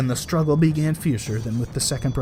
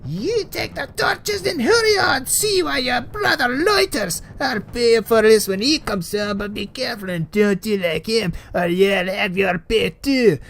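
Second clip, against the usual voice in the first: put-on angry old witch; 9 semitones higher, 4 dB louder than usual. A narrator's reading. Old woman shouting orders at her son. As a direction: screech orders like an angry hag. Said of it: "impatient and angry".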